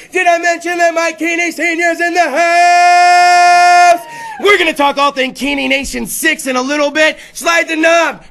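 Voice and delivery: slow, loud hype-man announce; announcer hype. Acted. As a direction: sell the guest like a ring announcer. Host announcing guests.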